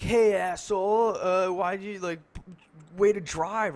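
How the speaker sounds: high, loud mock heckle; imitated angry driver. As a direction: imitate a driver calling someone out, drawn out at the start. Driver confronts him in garage.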